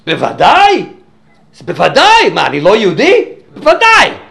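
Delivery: high, loud offended outburst; indignant shout. Retold or imitated. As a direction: bellow it as if insulted by the question.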